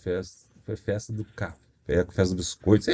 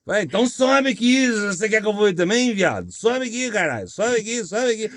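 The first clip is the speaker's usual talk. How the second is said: calling out challenge; 7 semitones higher than usual. Retold or imitated. Calling the heckler onto stage.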